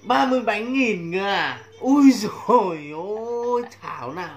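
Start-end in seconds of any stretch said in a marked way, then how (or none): 0.0-1.6 to someone hard of hearing. Pretend. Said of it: "Em nói to lên anh không nghe được". 1.8-3.6 loud, slow, stretched-out wow; amazed exclamation. Pretend. Amazed at the cake price.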